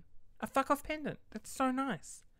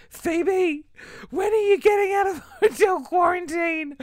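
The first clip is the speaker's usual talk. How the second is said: desperate call; 12 semitones higher, 12 dB louder than usual. Retold or imitated. Calling out to employee Phoebe.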